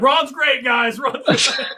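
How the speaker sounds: high, laughing, mock-bright assurance; forced cheerful shout. Student answering the professors about Ron, laughing high shout. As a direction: chirp it out with a laugh, overly upbeat. Acted cheery reply to TAs.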